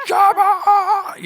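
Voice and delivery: high, loud mock scream. Re-enacting screamed prayer on the basement treadmill, screaming.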